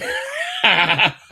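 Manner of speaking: a loud real laugh at the mispronunciation. Real, not acted. Host laughing at a viewer's story of a mispronunciation, loud laugh. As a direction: burst out in a loud, high laugh.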